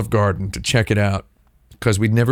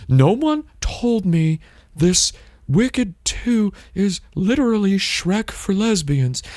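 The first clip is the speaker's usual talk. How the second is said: high, slow mock quoting of a smug poster; 7 semitones higher than usual. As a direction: lightly put on voice of a smug guy. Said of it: "before posting".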